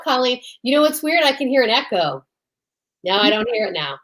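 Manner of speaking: ordinary delivery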